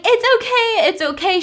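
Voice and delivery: high, airy it's okay; breezy reassurance. Retold or imitated. Adele getting up after falling on the sidewalk, breezy. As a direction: brush it off sweetly.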